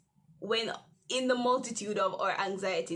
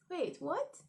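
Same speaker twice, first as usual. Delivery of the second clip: startled disbelief; 6 semitones higher, 5 dB quieter than usual. Retold or imitated. Reading teacher's message.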